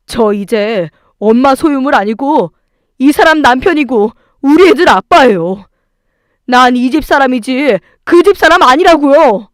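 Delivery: loud, resolute, building to a shout; firm angry declaration. A narrator's reading. Husband cutting ties with his mother, angry declaration. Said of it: "남편은 단호하게".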